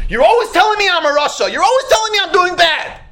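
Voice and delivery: high-pitched, aggrieved protest; mock indignant complaint. Driver complains to his warning device, mock indignant.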